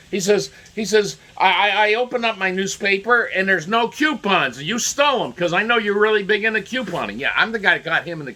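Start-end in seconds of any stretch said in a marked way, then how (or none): 1.4-7.2 higher, louder indignant accusation; angry neighbor. Retold or imitated. Neighbor blaming him for missing coupons, indignant. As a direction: rant like a wronged man, accusing. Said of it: "cursing and angry".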